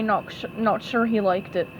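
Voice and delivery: ordinary delivery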